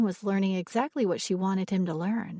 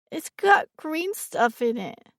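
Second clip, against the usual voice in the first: put-on high, slow whiny child; complaining child; 9 semitones higher, 5 dB louder, slower than usual. A narrator's reading. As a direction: whine it close to tears. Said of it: "she complained".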